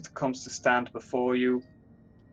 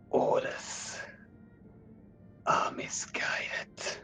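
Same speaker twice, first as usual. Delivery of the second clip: whispering; 6 dB quieter, much slower than usual. Acted. Conscience questions the orders.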